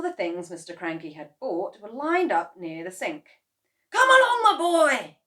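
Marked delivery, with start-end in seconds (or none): 3.9-5.2 high, loud, eager call; excited cry. A narrator's reading. Mister Cranky urging George on, loud and high. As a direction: cheer the boy on loudly. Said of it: "cried Mister Killy Cranky".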